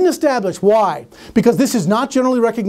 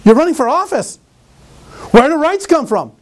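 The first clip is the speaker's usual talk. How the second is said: pressing the question, higher and louder; insistent challenge; 6 semitones higher, 3 dB louder than usual. Retold or imitated. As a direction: push the candidate to answer. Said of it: "She goes".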